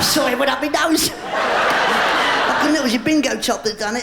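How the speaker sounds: flustered laughing. Real, not acted. Water up nose, flustered.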